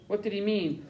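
plain reading voice

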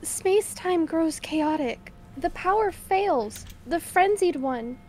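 slow, measured prophecy; mysterious ominous voice. Acted. Player reading the god's warning, slow and ominous. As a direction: intone it like a distant god warning.

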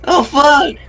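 very high, loud protest; anguished yelling. Real, not acted. Player losing to two guys in game, high anguished yelling. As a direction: scream it out in dismay.

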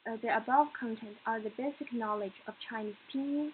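ordinary delivery

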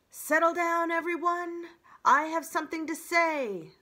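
high, loud owl addressing a crowd; loud, high-pitched storybook owl announcement; hooting owl call. A narrator's reading. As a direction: call the meeting to order in a ringing hoot. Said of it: "Swooper the owl hooted to the crowd".

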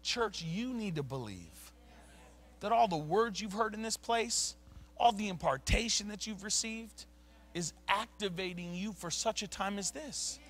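ordinary talk, nothing marked

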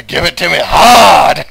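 loud, high, hammed-up cry leaning on hard. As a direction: push the plea to its peak. Mock ecstasy, escalated take.

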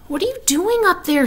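high-pitched, inquisitive question; curious young pig. A narrator's reading. Wilbur curious in the dark.

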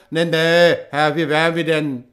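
loud, broad fisherman's voice; rough fisherman. A narrator's reading. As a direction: answer like a coarse fisherman, loud. Fishermen deny to the abbot. (lauter, derber Fischer)

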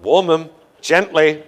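a hint of a loud patient barking orders; loudly bossing the doctor, half joking; mock-commanding patient in the stirrups; mock demanding patient. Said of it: "And I would say".

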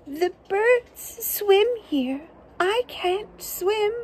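high, slow little critter voice; small animal voice. Acted. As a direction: whine it like a tiny worried animal.

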